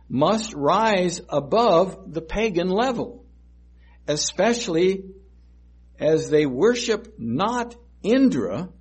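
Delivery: rising sermon climax; full-force preaching. Acted.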